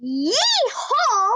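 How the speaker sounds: mock cowboy yeehaw; dad's cowboy yeehaw. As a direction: holler it like a cowboy dad. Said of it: "So he sounds like this".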